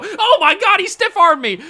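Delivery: real disbelief as the run breaks free; loud, high-pitched exclamation; shocked outburst. Real, not acted. Opponent stiff-arms his defender.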